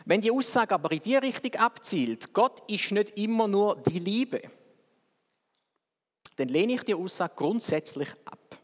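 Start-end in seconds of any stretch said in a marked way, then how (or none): none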